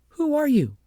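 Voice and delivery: put-on high, loud girlish voice for Agnes; Agnes demanding. A narrator's reading. Agnes demands the strange child's name, high and loud.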